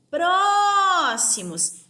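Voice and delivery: "Próximos" with PRÓ held long, high and loud; slow "Próximos", hollered on its opening syllable; stressed syllable called out. Shown as a demonstration. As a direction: call out "Próximos", stretching the first syllable. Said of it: "Eu chamo a palavrinha como se".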